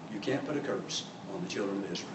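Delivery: very soft, low repeat of a command; hushed warning. Acted. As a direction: drop right down to a grave hush.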